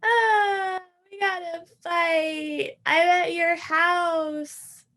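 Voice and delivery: high, tearful, slow whimpering; crying. Retold or imitated. Drunk man phoning her, crying about a fight with his wife. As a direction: blubber it out through tears.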